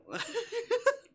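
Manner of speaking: high burst of laughter. Real, not acted. Laughing at the game's reply.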